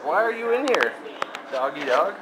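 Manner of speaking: ordinary delivery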